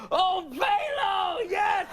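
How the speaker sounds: slow, very high elated shout; overjoyed cry. Retold or imitated. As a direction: cry out as if finally rescued. Acts out his overjoyed reaction.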